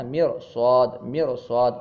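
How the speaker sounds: "میر و صاد" with a heavy, full r. Shown as a demonstration. Teacher asks students to hear the difference, "میر و صاد" heavy. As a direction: thicken the ra in "میر و صاد", repeating it. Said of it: "دونوں فرق ہے نا".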